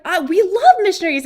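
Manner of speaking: high, loud, quick enthusiasm; gushing delight. Retold or imitated. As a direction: imitate an overjoyed host gushing. Acts seller's delight at missionaries.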